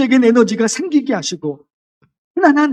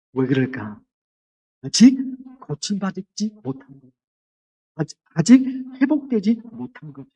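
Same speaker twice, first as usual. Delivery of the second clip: ordinary delivery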